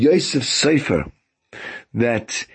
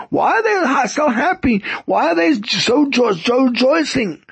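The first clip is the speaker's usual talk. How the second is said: retold upset shout; 11 semitones higher than usual. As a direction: imitate someone upset, scolding a crowd. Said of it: "he shouted at them".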